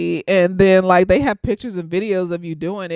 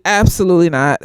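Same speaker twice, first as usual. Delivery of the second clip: ordinary talk, nothing marked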